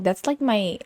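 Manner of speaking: normal talking voice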